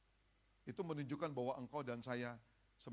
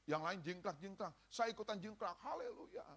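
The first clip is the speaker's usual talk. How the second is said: mock cheer; 7 semitones higher than usual. Mock hollow joining in worship.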